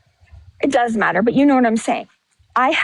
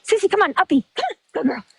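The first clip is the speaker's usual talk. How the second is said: coaxing the dog; 4 semitones higher, much faster than usual. Real, not acted. Owner calling her dog Sissy again, higher coaxing pet voice.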